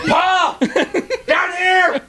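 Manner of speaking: high, far-carrying holler; mock calling out. Hunter showing how he should have called Pa, high holler. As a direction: call for Pa across the woods.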